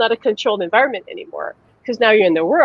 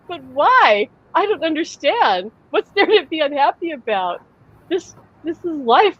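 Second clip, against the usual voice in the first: put-on higher, wide-eyed child's wonder; innocent child; 7 semitones higher than usual. Son puzzling over unhappy people, higher and wondering. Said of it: "To him, it was like".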